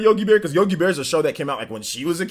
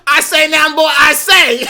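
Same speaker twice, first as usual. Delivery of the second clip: very loud, high southern bluster; booming hammed-up southern shout; blustering southern man; 13 semitones higher, 13 dB louder, slower than usual. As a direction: holler like a cartoon southern blowhard. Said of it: "imagine Fog, Fog".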